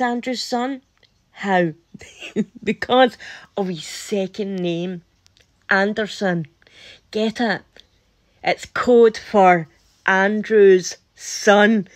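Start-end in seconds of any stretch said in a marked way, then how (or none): none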